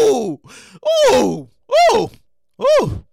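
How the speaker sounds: short, loud "ooh" whoops; comic oohing. Acted. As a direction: hoot in exaggerated amazement, spaced out. Comic whooping over the stolen meat.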